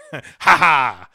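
a gleeful little villain laugh from a lurking spider; mock evil spider laugh; spider cackle. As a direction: cackle like a bug that just sprang its trap. Spider pops out of the visor.

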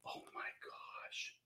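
awed breathless whisper. Real, not acted. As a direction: whisper it in amazement. Opening the mystery package.

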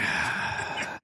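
long deep sigh out; heavy nervous exhale. Real, not acted. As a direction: blow out a shaky breath. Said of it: "Heavy breathing".

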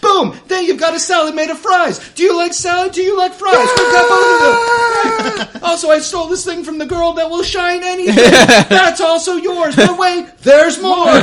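loud, high, excited TV pitchman; home shopping host. Acted. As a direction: sell it like an infomercial host. Camera swings, galley cook pitches fries.

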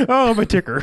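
high, hammed-up cry of distress; mock heart attack. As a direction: play a comic heart attack. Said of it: "when they're having a heart attack".

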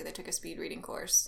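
whispered aside; breathy joking whisper. Real, not acted.

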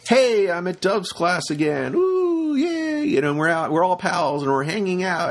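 mock a chummy know-it-all; goofy student voice.

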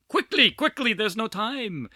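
high-pitched, hammed-up action-movie urgency; horrible Taken impersonation. Host racing the clock to wrap up, mock-urgent movie impression. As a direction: badly impersonate an urgent film hero. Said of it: "that was my horrible Taken, impersonation".

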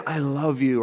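soft, sincere declaration; tender. Shown as a demonstration. Showing how tone changes words, tender. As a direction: say it gently and mean it. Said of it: "thirty percent is the tone".

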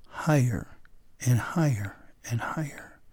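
low-key and whispery, word by word; hushed climbing narration. A narrator's reading. Storyteller follows Alexander up the mountain, hushed.

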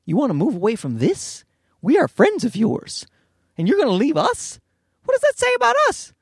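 mock indignation climbing high; offended friend, pitch rising sharply to the end; personally insulted. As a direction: act wounded, building to a squeaky protest. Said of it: "we take it as a personal insult".

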